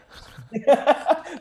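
a burst of high bright laughter. Real, not acted. Laughing at his own naive past self. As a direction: laugh out loud at the joke.

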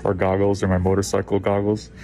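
muffled behind respirator mask; through a face mask. Real, not acted. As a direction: mouth covered by mask. Seen 4: Speaker in phone video wears N95 mask over mouth throughout.

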